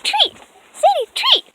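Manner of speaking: high sing-song treat call; talking to a dog. Real, not acted. Calling dogs for a treat.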